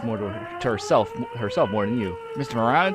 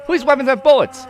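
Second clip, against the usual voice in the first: high, loud insistent objection; agitated protest; 12 semitones higher, 9 dB louder than usual. Acted. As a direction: shout it in disbelief. Voices woman disbelieving gun is empty.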